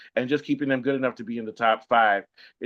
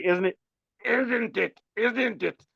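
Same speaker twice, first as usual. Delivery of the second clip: high, repeated hammed-up British "isn't it"; overdone British tag question; mock British "isn't it"; 8 semitones higher, much slower than usual. Host mocking British speech, high repeated "isn't it". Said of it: "you know what they say".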